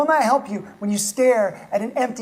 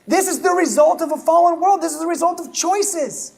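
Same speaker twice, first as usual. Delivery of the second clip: higher, louder, hammering God is not; full-force preaching; 6 semitones higher, 4 dB louder than usual. Acted. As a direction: preach it out to the congregation.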